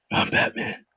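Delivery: whispered gravel-free Batman. Acted. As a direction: breathe out a hushed Batman line. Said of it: "do you want me to do Batman".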